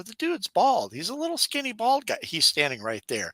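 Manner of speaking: high, incredulous retold protest; mock indignant disbelief. To the shot-buying guy, high mock outrage. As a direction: squeak out the outrage at being mistaken.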